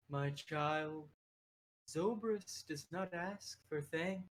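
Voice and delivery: lightly voiced creature sage; drawn-out, understated creature voice; little creature oracle. Creature gently refuses an adventurer's thanks, in character. Abel addresses the player.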